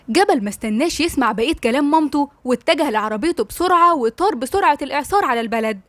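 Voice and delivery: ordinary delivery, nothing marked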